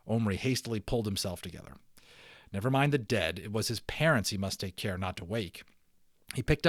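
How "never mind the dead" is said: usual speaking voice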